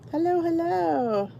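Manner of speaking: high, slow singsong hello; greeting a viewer who just joined, singsong and slow. Real, not acted. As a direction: sing out the welcome to a newcomer. Greets new viewers joining stream.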